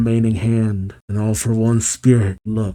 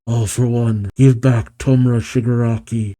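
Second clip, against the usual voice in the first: ordinary delivery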